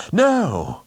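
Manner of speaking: sharp, high, loud anguished cry; cry of pain. Acted. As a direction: blurt it out as if struck. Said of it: "It was almost a cry of pain".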